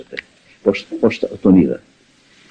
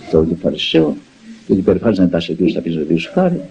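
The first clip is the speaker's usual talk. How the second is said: ordinary talk, nothing marked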